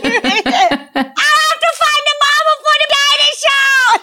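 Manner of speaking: drawn-out, very high mock child scream; screaming toddler. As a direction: wail it slowly like a kid yelling in sleep. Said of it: "She's screaming".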